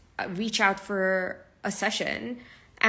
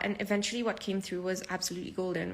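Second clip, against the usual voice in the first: normal talking voice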